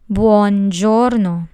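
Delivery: "Buongiorno" said slowly and loud, bwon-JOR-no; careful Italian buongiorno. Shown as a demonstration. Teacher models full buongiorno after syllables, slow and careful. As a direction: model the whole word slowly for learners. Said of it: "This word has three syllables".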